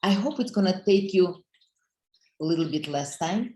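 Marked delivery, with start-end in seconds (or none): none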